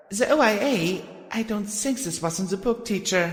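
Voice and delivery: high, quick filly protest. Acted. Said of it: "I protested".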